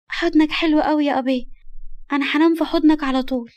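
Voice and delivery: put-on high, childish cuddly voice; affectionate little girl. Little girl cuddling her guardian, childlike. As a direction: speak like a contented small child.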